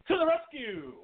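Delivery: high, cried-out heroic call; mock-heroic rescue cry. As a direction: announce your arrival like a cartoon hero. Alan arrives heroically.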